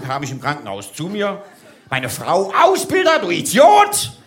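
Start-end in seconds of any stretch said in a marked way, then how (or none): 2.6-4.1 high, loud angry wife yell. Retold or imitated. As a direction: scream at the idiot husband. Wife yells at him. (keifende Ehefrau, hoch und laut)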